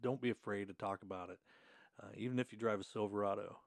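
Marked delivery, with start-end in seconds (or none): none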